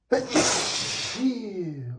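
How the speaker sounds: string of blasting sneezes; loud sneezes. Acted. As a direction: sneeze hard, again and again.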